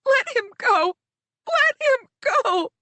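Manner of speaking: high desperate screams, rising each time; screaming and crying. Acted. Narrator screaming for Riven, crying and high. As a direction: scream it, breaking with despair. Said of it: "I'm screaming and crying".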